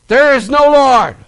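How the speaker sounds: angry neighbour firing back loudly; frustrated retort. Retold or imitated. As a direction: shout back in contradiction. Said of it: "very frustrated and very angry".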